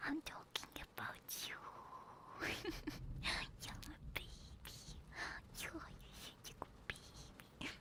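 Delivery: soft whispered cooing to a cat; cooing pet talk. Real, not acted. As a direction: coo it tenderly, fading into a whisper.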